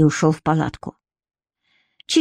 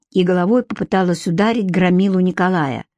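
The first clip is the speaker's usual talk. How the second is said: ordinary delivery, nothing marked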